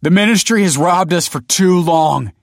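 loud, high rallying cry. A narrator's reading. Rebel addressing crowd of neighbors, loud impassioned cry. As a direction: rouse a crowd against their rulers. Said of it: "he cried".

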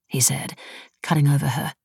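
plain reading voice

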